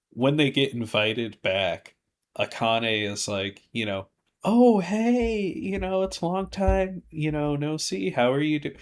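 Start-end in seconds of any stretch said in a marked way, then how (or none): 4.4-8.8 high-pitched, hammed-up casual hello; imitated coy greeting. Akane voiced greeting the owner hopefully.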